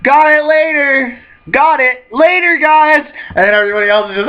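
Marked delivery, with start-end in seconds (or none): 0.0-1.2 carefree call. Retold or imitated. Luffy calls out falling, repeated. 1.5-3.0 high, loud breezy shout; carefree call. Retold or imitated. Same call repeated.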